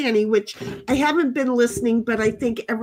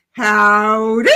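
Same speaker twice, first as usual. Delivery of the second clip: cowboy howdy; 8 dB louder than usual. Acted. Reading a chat greeting in character.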